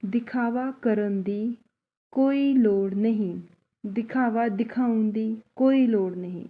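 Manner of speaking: slow, deliberate Punjabi sentence; slow teaching demo. Shown as a demonstration. Teacher slowly models the full Punjabi sentence. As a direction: say it slowly so students can follow. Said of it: "इस तरह से हम इस sentence को पंजाबी में बोलते हैं".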